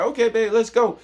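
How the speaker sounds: high, breezy, pretend-casual agreement; woman acting casual. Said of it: "They may be like".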